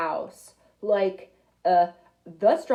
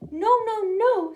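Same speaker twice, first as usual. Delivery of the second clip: high, louder squeaky wolf cubs; little wolves refusing; 10 semitones higher, 5 dB louder than usual. Acted. Scared little wolves answering the pig, high and loud. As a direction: cry out no in little voices.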